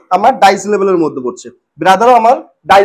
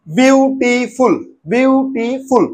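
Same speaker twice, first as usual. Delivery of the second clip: "Beautiful" very slowly in three separate beats; split into syllables; 3 semitones higher, much slower than usual. Shown as a demonstration. Teacher demonstrating a trisyllable, "beautiful" slowly broken in three. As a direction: draw out each part of "beautiful", with gaps between. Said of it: "তিনবার যখন ভাঙবো".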